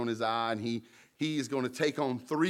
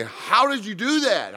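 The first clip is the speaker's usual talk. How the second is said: much higher and louder question; astonished; 9 semitones higher, 5 dB louder than usual. Retold or imitated. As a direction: ask in stunned disbelief. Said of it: "And you say".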